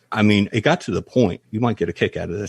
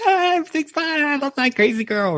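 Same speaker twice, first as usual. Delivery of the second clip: much higher, hammed-up carefree voice; mock falsetto. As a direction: brush it off in a silly high voice. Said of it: "you're like".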